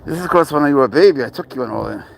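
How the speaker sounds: ordinary delivery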